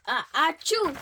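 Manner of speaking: sudden acted achoo; sentence-breaking achoo; loud mock sneeze. A narrator's reading. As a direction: burst out with a loud fake sneeze. Elephant sneezes mid-word.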